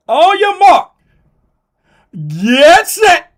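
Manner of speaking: high, very loud drawn-out race call; race start call. Acted. Host calling the start of the race loudly.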